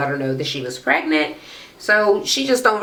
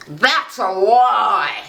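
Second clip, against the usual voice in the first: hammed-up slow drawl, pitched up; mocking catchphrase imitation; 3 semitones higher, much slower than usual. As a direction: stretch each word like a theatrical accuser. Mimicking cast member's catchphrase.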